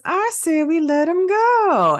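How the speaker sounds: very high, slow disguised voice; thrown voice. Retold or imitated. Captive posing as one of the bikers, thrown voice. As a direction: imitate someone posing as another member of the crowd. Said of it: "he tries to throw his voice".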